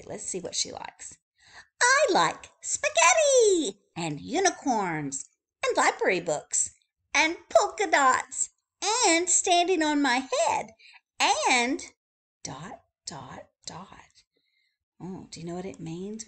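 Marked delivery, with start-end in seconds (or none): 1.8-11.8 high, eager rattling list; chatty bird voice. Acted. Wordy Birdie telling readers what she likes, high and eager. 12.4-14.2 soft breathy whisper trailing off; whispered dots. Acted. Narrator whispering the three dots at the list's end.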